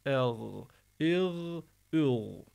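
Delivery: "El, il, ül" drawn out into a voiced gh; rubbed throat. Shown as a demonstration. Teacher showing Qur'an reading, scraped "El, il, ül". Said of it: "böyle sürteceğiz".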